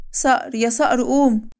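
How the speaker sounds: high, slow calling out; urgent waking. Acted. Pregnant wife waking her sleeping husband, slow high urgent call. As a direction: drag him awake, calling high.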